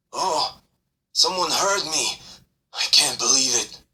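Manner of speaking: high relieved voice; relieved, can hardly believe it; relieved creature. Acted. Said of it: "He sighs with relief".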